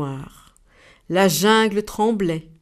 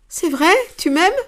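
higher and louder, beaming; smiling, delighted; 9 semitones higher, 6 dB louder than usual. Acted. The giraffe answers the dove, higher with a smile. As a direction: ask with a big smile. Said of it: "La girafe sourit".

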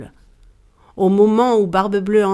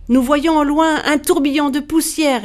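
higher-pitched answer from afar; calling back; 6 semitones higher than usual. A narrator's reading. Sisters reporting from the ramparts, high call. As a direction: call the sighting down to her.

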